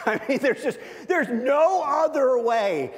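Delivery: laughing, high and loud, slowing down; joyful laugh. Real, not acted. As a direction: laugh through it in happy disbelief. Laughing at the miracle.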